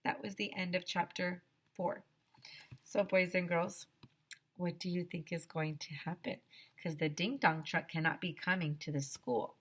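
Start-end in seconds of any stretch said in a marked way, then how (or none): none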